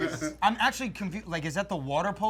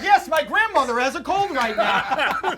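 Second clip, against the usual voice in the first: mock polite excuse; 7 semitones higher, 7 dB louder, slower than usual.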